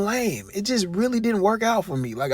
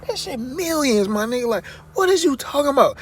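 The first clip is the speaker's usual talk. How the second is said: louder and higher, replaying his own exasperated comeback; heated retort; 7 semitones higher, 3 dB louder than usual. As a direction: throw it back at him, incredulous and loud. Retold heated retort to Gillie.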